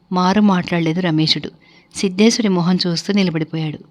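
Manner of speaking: plain reading voice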